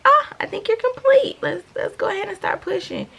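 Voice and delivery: imitating the cheerful doctor who says it's time to push. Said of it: "she was like".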